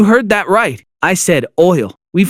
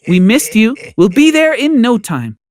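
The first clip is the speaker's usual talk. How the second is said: sugary lying mom; 7 semitones higher than usual. Acted. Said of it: "Mom lied".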